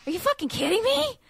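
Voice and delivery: overjoyed "Are you fucking kidding me?"; excited take. Shown as a demonstration. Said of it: "do you think he's excited about it".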